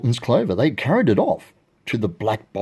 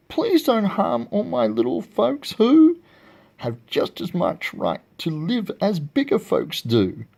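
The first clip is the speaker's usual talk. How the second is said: high-pitched storybook pleading; begging; 8 semitones higher than usual. A narrator's reading. Horton to the eagle for his little folks, begging. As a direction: plead earnestly, pitch raised. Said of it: "and begged".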